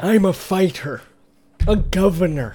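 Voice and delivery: gruff tough-guy voice; Jesse Ventura impression. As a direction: boast like a wrestler governor. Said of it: "Remember my Jesse Ventura?".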